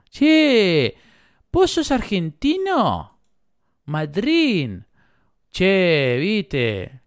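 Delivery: high-pitched, sing-song Argentine impression; hammed-up Argentine lilt with drawn-out vowels; mock Argentine accent. Mock Argentine voice on learning the town.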